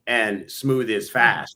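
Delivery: said very slowly; slow emphasis. Shown as a demonstration. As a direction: stretch out each word slowly. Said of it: "Sometimes you gotta slow down".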